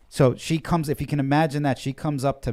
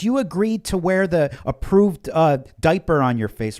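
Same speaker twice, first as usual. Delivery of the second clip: mock stern attendant; 4 semitones higher, 6 dB louder than usual. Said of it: "She goes".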